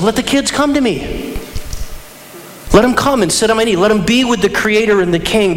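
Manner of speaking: insistent welcome. Acted. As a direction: call the children in over objections. Jesus overrules disciples, welcoming the children.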